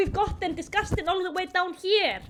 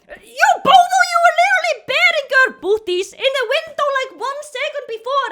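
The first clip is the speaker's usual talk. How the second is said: louder, faster, higher disbelief; exasperated rant; 7 semitones higher, 7 dB louder, faster than usual. Real, not acted. Vlogger ranting at hypocritical movie characters, exasperated. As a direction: call them out fast, incredulous.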